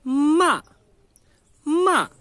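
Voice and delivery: "ma" starting high and loud, dropping sharply; forceful downward Mandarin tone on each "ma"; falling fourth tone. Shown as a demonstration. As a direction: hit "ma" hard from high up and let it fall. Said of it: "uma entonação decrescente".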